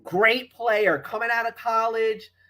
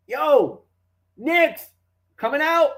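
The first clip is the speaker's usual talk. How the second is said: calling out; 6 semitones higher, 4 dB louder, slower than usual. Retold or imitated. Calling out to the players.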